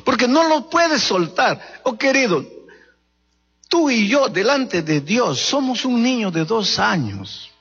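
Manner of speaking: fervent preacher at full force; impassioned preaching. Acted. Litany "quiere ser feliz" preaching.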